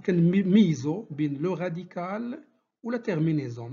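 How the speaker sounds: ordinary talk, nothing marked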